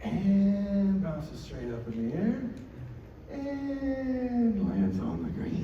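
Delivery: slow-motion play-by-play; drawn-out suspense. Retold or imitated. Telling a miracle shot in flight, drawn-out suspense. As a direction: stretch the first word high and long.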